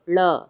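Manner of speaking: the dotted ड said as a flapped "ळ"; flapped retroflex ड़. Shown as a demonstration. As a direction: flick the tongue for "ळ". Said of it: "तो हम इसको बोलेंगे".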